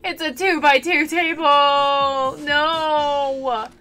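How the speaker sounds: slow, hammed-up fake crying; mock sobbing. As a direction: pretend to weep over it. Mock grief over small table.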